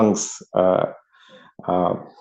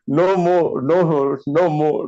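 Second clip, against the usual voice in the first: ordinary talk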